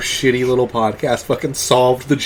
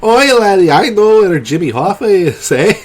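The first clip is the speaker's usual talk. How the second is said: higher-pitched, hammed-up Irish caller; Irish accent; 4 semitones higher than usual. Caller phoning back to sound more serious, Irish accent. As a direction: put on a lilting mock Irish accent. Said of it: "I call back with an Irish accent".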